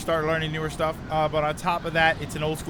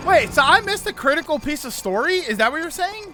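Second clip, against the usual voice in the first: surprised; 10 semitones higher, 5 dB louder than usual. Real, not acted. Shocked at missing story.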